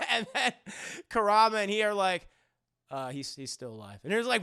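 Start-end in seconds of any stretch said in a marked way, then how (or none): 2.9-4.0 low, soft, understated correction; deadpan. Retold or imitated. Friends correcting the wailing mourner, low deadpan. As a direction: drop the voice and state it plainly.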